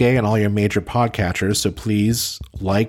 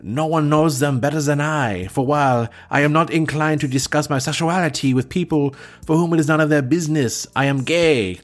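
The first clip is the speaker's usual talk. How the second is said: high, hammed-up French Canadian accent; 6 semitones higher than usual. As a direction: overwrought French Canadian hero voice. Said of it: "I can't do a French Canadian accent, so I won't even try. Okay, maybe I will".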